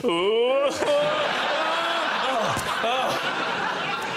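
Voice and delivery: a sudden loud ooh dropping into groans; mock dread at opening a present; mock gasp of dread. Al-Qaeda man opening birthday gift, mock dread.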